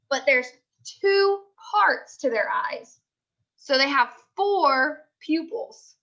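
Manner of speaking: slow, lively picture-book reading to a class; reading aloud to children. Acted. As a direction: read it like a teacher sharing a book with kids.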